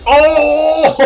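high, loud "oh" bursting into laughter; elated whoop and laugh. Real, not acted. Gamer thrilled by his catapult shot, elated whooping laugh. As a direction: whoop loudly, then laugh hard.

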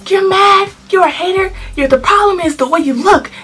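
high-pitched, sassy clapback; reenacted argument, high and cocky; retold taunting. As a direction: taunt her with loud attitude.

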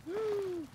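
sharp gasp after surfacing; gasp for air. Real, not acted. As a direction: gasp like coming up from water. Surfacing underwater.

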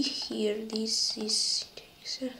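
whispered under the breath; whispering. Real, not acted. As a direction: whisper it to yourself. Thinking aloud over puzzle.

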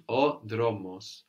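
slow, careful "O dromos" with the o open; Greek pronunciation. Shown as a demonstration. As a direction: spell out "O dromos" slowly for the learner.

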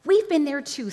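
loud, high retort; sharp, indignant girl; snapped. A narrator's reading. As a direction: snap back angrily. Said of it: "snapped Jill".

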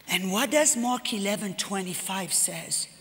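low, hushed divine question; Holy Spirit gently pressing the point; soft pointed question. Retold or imitated. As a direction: ask it quietly so it lands. Holy Spirit asks gently, convicting.

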